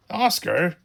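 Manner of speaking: loud, high shout of a name; startled dad yelling his son's name. A narrator's reading. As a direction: shout it in shock. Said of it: "yelled Dad".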